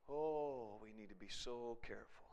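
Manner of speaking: low, very soft warning; grave, hushed caution. Real, not acted. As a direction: warn them gently, barely raising the voice. Grave warning after the tragic story.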